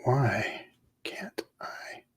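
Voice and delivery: slow, pained whisper. Real, not acted. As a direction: whine it in a whisper. Frustrated at computer, whispered.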